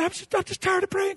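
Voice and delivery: high, quiet tearful complaint; mock weepy voice; imitated crying. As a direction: mimic her starting to cry as she speaks. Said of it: "she starts crying".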